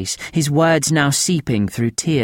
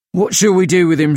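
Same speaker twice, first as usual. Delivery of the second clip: eager reeve; 6 semitones higher, 5 dB louder, faster than usual. A narrator's reading. Reeve Featherby eager, pressing.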